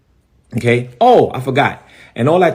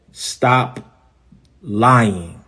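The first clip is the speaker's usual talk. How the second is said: low, with a long gap between words; measured warning; 3 semitones lower than usual. Real, not acted. Pastor calling out a rival's lies, slow measured warning. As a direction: say "Stop", wait, then let "lying" fall low. Repeats the accusation word by word.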